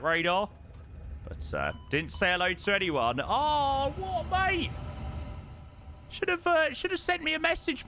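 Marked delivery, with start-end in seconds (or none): 3.2-7.9 mock surprised. Real, not acted. Reacting to a viewer's message.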